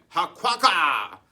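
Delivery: slow, loud quacking jeer; mocking duck laugh.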